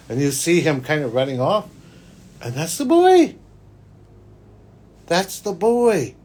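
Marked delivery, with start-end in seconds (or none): 2.4-3.3 high, emphatic reveal; much higher, louder reveal; revelation. Retold or imitated. Reveal in vision story. 5.1-6.1 slow emphasis. Retold or imitated. Reveal repeated.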